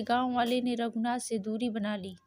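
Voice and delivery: usual speaking voice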